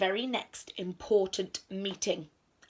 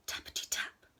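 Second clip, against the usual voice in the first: hushed tap-tap sound words; whispered tapping; 8 dB quieter than usual. A narrator's reading. Storyteller voices knocking at Owl's door, whispered.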